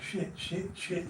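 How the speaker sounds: retold worried muttering. Man recalls his nerves at reception, worried muttering. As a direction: curse softly under your breath.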